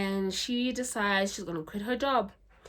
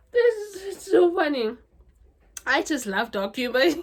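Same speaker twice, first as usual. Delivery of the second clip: loud, laughing while talking; 9 semitones higher, 7 dB louder than usual. Real, not acted. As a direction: still cracking up. Still laughing.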